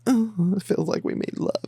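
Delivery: mock dreamy woman. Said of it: "she's all like".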